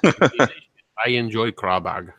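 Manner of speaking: very slow mock accent, "Kralbug" drawn out; mock foreign accent. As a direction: play the foreign guest enjoying crawfish, slow. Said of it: "if they talk like that".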